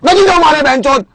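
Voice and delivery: angry refusal. Acted. Refusing to use the bathroom before the highway, angry shout. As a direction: yell it out, fed up with them.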